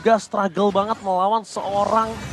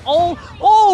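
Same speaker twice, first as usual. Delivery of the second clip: sudden high yelp of shock; surprised shout; 9 semitones higher, 8 dB louder than usual. Real, not acted. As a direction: cry out as the play turns. Sudden kill in live fight. (teriakan kaget)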